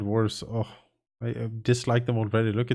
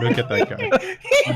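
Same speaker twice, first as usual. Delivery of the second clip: high, slow laughing delight; 5 dB louder, slower than usual. Real, not acted. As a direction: squeal it out laughing. Admiring the Faker, laughing.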